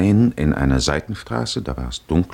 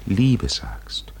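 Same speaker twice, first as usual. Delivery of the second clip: whisper; 4 semitones higher than usual. Acted. Intimate address to Odysseus.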